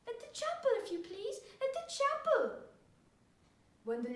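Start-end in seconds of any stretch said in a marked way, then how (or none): 0.1-2.4 high-pitched mocking imitation of a child; mock the boy's words, half to yourself; mimicking his son. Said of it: "began to mimic his son's flat accent, saying half to himself".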